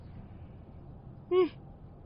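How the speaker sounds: long airy yawn; wide open-mouthed yawn; big real yawn. Real, not acted. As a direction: let out a full yawn. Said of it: "I always yawn when I'm podcasting".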